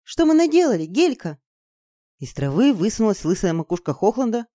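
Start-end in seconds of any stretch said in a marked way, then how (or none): 0.0-1.4 high, dismayed outcry that drops; horrified. Acted. Izergil to her friend, horrified. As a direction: lament what has been done. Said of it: "с ужасом сказала Изергиль".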